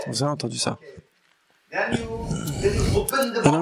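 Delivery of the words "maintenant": high and very slow, stretching the word; suspenseful reveal. Acted. Teacher telling the story as the box is opened, high and drawn out. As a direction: build the suspense before the big moment.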